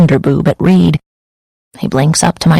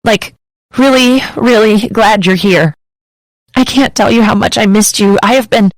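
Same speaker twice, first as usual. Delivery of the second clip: higher, tearful pleading, nearly breaking; higher teary rush, relieved he's here; crying; 5 semitones higher than usual. Acted. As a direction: let the sob spill over the words. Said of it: "I say through a sob".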